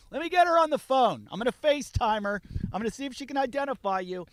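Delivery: high, goading retold comeback; mock-helpful taunting. Storyteller messing with the screaming driver, taunting. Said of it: "I'm fucking with him".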